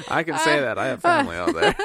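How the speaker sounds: high, laughing through the words. Real, not acted. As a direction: giggle while you justify it. Laughing defence of remark.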